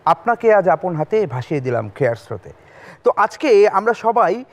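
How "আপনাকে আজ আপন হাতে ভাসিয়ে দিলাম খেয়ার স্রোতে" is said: poem recitation. Acted. Recites poem lines as opener.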